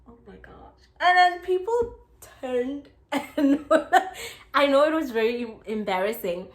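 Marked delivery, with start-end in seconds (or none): 0.1-0.8 whispered dismay. Retold or imitated. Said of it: "I'm like".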